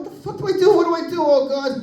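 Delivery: put-on high, frantic little-kid panic; a boy panicking at his desk, frantic and high; mock panic.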